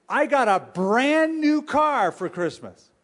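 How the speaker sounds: high, loud, drawn-out mock excitement; gleeful bragging. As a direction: show off to a friend with exaggerated glee. Acted friend bragging about new car.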